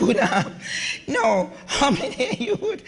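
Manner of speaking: real laughter breaking up the question; laughing. Real, not acted. Speaker polling the audience, laughing through the question. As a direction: try to ask it, cracking up.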